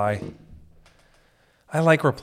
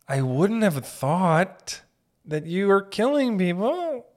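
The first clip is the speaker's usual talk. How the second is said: slow mock disbelief; 7 semitones higher, 4 dB louder, slower than usual. Mock disbelief at Hillary Clinton.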